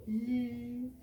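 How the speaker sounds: "L" as a long held "lll"; letter sound. Shown as a demonstration. Teacher sounds out L for the class, held. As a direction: hum the consonant "L". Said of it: "van a ser con L".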